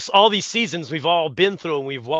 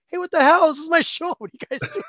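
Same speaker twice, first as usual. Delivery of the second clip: high-pitched indignant protest; comic outrage, voice pitched way up; mock pissed-off comedian; 13 semitones higher, 6 dB louder than usual. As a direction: imitate a performer whose show got hijacked. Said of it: "he's pissed off".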